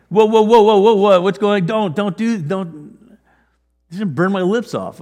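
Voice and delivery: panicked protest. Retold or imitated. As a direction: back away in panic from the burning coal. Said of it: "Now there's a fear moment".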